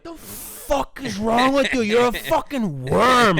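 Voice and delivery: loud, high, drawn-out scolding; berating boss. Retold or imitated. Old New York boss to new employee, loud drawn-out berating. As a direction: imitate a New York boss chewing someone out, stretching the words. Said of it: "my old boss would be like".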